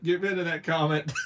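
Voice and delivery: giggling order; laughing. Real, not acted. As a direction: say it again through laughter.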